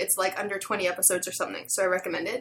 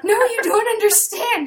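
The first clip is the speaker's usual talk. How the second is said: high, loud laughing desperate insistence; desperate plea; 12 semitones higher, 8 dB louder than usual. Retold or imitated. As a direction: beg him, half-laughing, to listen. Girl pleads with shop guy.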